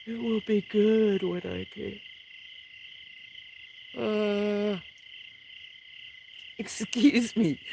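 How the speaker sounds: talking through a big yawn; words stretched by a yawn; yawning while talking. Real, not acted. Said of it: "How many yawns did I do?".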